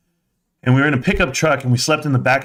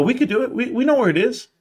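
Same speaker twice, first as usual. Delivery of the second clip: eager insistence; 12 semitones higher, faster than usual. Retold or imitated. Kid insisting to dad.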